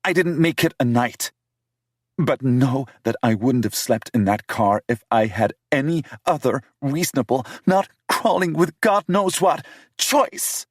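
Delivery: indignant young woman, voiced high; Ada's voice. Female character voice, narrator reads romance.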